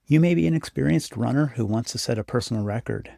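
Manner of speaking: normal talking voice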